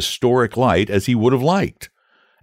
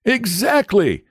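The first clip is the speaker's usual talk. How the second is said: pleasant knight; 13 semitones higher, slower than usual. Acted. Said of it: "smiling pleasantly".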